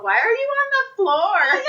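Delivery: put-on high-pitched, baffled old-lady cry; drunk old lady. Witness mimicking a drunk old lady puzzled by the fall, baffled high voice. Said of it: "there was a drunk old lady".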